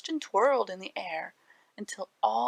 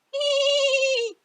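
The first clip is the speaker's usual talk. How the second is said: high, squeaky "hee" of a rabbit; a quick high rabbit giggle; shrill rabbit laugh; 5 dB louder than usual. A narrator's reading. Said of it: "a shrill laugh of the rabbit".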